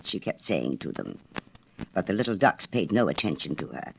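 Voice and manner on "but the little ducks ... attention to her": ordinary delivery, nothing marked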